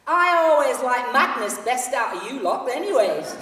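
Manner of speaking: loud laughing Yorkshire call; loud fan's last word. Acted. Yorkshire fan calls after the departing singer, loud and laughing. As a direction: shout it after her, chuckling. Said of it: "he said to my departing back".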